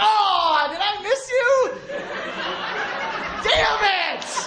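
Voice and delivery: high, slow, overexcited fake cheer; leaving a voicemail to someone you dodged, overeager and high; way too excited. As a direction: gush with fake disappointment. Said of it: "you sound way too excited".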